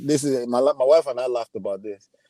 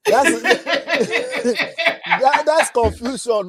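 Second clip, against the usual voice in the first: laughing through the words, high; real laughter breaking in; 11 semitones higher, much slower than usual. Real, not acted. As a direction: giggle out the line. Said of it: "please don't make me laugh".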